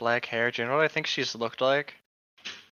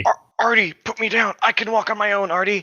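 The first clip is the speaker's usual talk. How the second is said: higher, louder put-upon protest; mock protest; 7 semitones higher, 7 dB louder than usual. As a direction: whine to be put down. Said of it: "Ava protested weakly".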